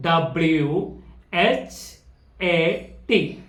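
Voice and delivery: letters spelled out very slowly, one by one; slow spelling. Shown as a demonstration. Teacher spells a word slowly for the class. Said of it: "W H എന്ന് പറയുമ്പോൾ വ്".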